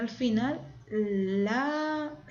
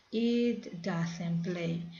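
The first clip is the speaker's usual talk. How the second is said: slow model; much slower than usual. Shown as a demonstration. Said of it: "teacher models English phrase".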